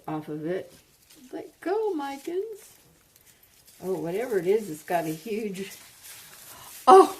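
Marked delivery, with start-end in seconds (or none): 1.3-2.7 high coaxing. Real, not acted. Tugging plant, talking to it. 6.9-7.2 sudden high loud oh; startled cry of delight; surprised yelp. Real, not acted.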